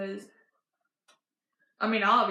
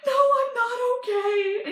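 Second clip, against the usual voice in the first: high, loud crying wail; hammed-up bawling through the words; mock tearful; 13 semitones higher, 5 dB louder than usual. Granddaughter in tears answering grandma, mock-bawling high and loud. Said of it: "tears coming down my eyes".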